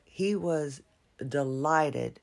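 normal talking voice

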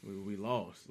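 Captain America telling the team they lost; defeated. Retold or imitated. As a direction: stammer it out like the fight is over. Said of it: "He said".

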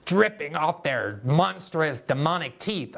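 slow, menacing mock villain voice; slow theatrical supervillain threat; James Bond villain. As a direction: ham it up like a Bond baddie. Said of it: "talking like a James Bond villain".

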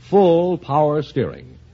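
normal talking voice

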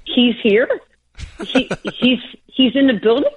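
high, halting astonished question; starstruck disbelief. Retold or imitated. Young athlete learning her idol is in the building, incredulous. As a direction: stammer it out in amused, mock disbelief. Retold astonishment at Ali being there.

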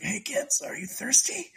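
high, croaky mock-parched voice; scratchy dehydrated voice. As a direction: imitate a dried-out dad croaking to his kids. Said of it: "He's got the scratchy voice".

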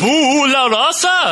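slow campy vampire question; Count Jacula vampire voice. Acted. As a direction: drawl it like a vampire. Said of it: "Count Jacula".

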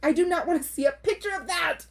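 high, emphatic protest; indignant refusal. Real, not acted. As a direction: refuse loudly in comic outrage. Mock outrage at unwanted picture.

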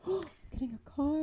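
high, soft, dreamy little voice; airy and oblivious; clueless self. Retold or imitated. Naive young self at the dealership, high and airy. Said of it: "I was just sitting there like".

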